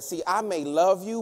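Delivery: usual speaking voice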